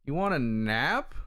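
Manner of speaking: usual speaking voice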